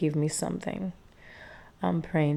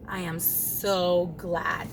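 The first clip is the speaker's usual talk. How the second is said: ordinary talk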